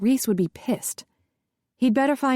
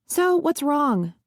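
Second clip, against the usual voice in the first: higher, slow and weary; impatient; 6 semitones higher, slower than usual. A narrator's reading. Son asking his mother what's wrong, slow and impatient. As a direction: drag it out, fed up.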